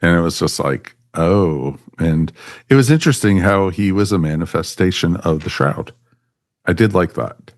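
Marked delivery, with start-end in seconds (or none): none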